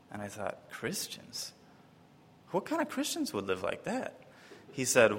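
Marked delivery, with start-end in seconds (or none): 0.7-4.2 breathless unvoiced start, then high and soft; baffled. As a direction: whisper the first word in disbelief, then rise high. Retelling his baffled reaction.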